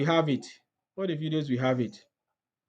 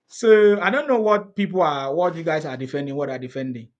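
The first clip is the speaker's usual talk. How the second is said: normal talking voice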